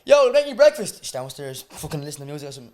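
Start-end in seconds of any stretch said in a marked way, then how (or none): 0.0-1.0 loud, raised call to someone in the house. Pretend. Boyfriend calling to deaf girlfriend downstairs about breakfast, calling out. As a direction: shout it up like a breakfast order. Imagined shout to deaf girl.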